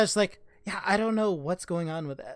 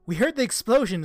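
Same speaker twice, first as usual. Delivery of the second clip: alarmed; 5 semitones higher, 6 dB louder than usual. Acted. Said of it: "And it's like".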